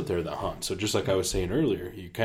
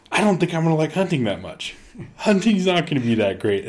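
higher mock-gloomy husband voice; imitating Chris; 8 semitones higher, 4 dB louder than usual. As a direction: play down the hunt in a put-on voice. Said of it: "And he's like".

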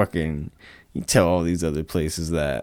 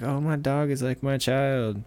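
doting owner voice, higher; mock the dog-as-child crowd; a light sweet mock of a pet parent; 7 semitones higher than usual. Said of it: "other people that are like".

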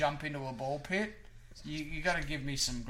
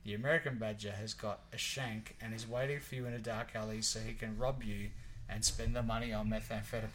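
usual speaking voice

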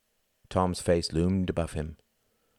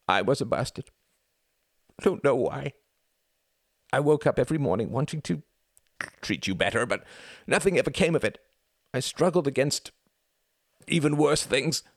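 high, louder anguished sobbing; tearful; 9 semitones higher, 4 dB louder than usual. A narrator's reading. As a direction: pour out the guilt through tears. Brother's tearful confession.

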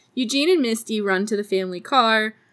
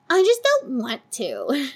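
higher, slow put-on whine; drawn-out petulant refusal, imitating; mock bratty whine; 4 semitones higher than usual. Rude customer refuses to rewind tapes, mock bratty.

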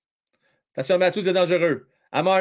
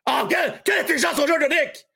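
high, quick exclamation; excited admiration; 10 semitones higher, faster than usual. Real, not acted. Commentator praising Nick's smart play, excited. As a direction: marvel at the clever play.

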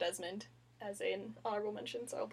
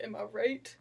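ordinary delivery

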